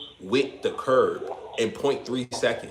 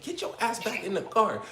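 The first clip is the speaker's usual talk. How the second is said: mock scold pitched much higher; imitating a scolding cousin; 9 semitones higher, 4 dB quieter than usual. Older cousin at McDonald's calling him back, a mock high scold. Cousin yelling from his car.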